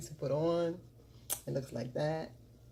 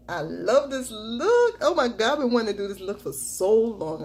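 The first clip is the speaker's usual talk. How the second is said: thrilled gush; 6 semitones higher, 9 dB louder than usual. Real, not acted. Beauty vlogger gushing to viewers about finally doing the look, thrilled and loud. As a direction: gush with real delight over the look.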